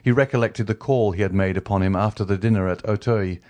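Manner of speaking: ordinary delivery, nothing marked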